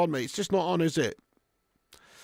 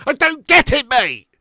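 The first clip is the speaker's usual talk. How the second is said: frustrated outburst; 5 semitones higher, 7 dB louder than usual. Real, not acted. Frustrated rant, louder.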